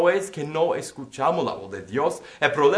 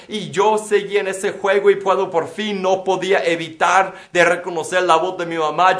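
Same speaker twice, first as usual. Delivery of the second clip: ordinary delivery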